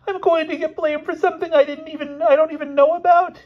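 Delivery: very high, squeaky wail; loud, squealing boy's complaint; distressed Elmer. Acted. Wrongly blamed boy to his friend, high squeaky distress.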